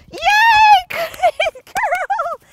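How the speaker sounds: high, loud squeal of praise, slowing at the end; delighted cheering. Real, not acted. Trainer gushing over her dog after a clean run. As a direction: gush over her with joy.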